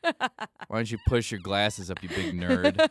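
lightly acted loud putdown; impatient businessman. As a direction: sneer as a businessman with no time. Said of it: "patience businessman just being like".

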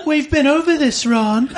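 put-on high, playful girl's voice, laughing; saucy Hermione. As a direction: tease him, winking. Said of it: "winked Hermione saucily".

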